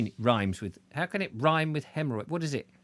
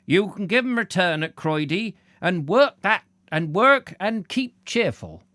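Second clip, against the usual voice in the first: put-on higher, louder old-man voice; raised voice, slowing to a hearty close; 6 semitones higher, 6 dB louder than usual. A narrator's reading. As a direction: call it out as an old countryman. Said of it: "said the old man".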